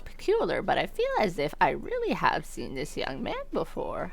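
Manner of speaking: mannered wondering, thin and soft at ends; puzzled cafe owner. Acted. Owner puzzling over familiar singer, soft mannered old voice.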